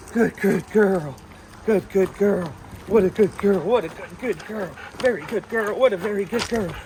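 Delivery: loud excited praise. Real, not acted. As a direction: pump the dog up with praise. Loud praise while heeling dog.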